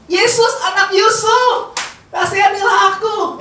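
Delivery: high, stretched mock cry for mercy; long-drawn pleading call. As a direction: imitate the beggar's shout. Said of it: "Kok nggak ngomong".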